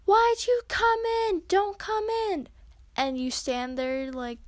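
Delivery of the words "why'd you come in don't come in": high, loud, outraged mock yell; imitating an angry shout, high and louder; retold angry yell. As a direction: shriek it like someone caught off guard in the bathroom. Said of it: "they start yelling and screaming at you".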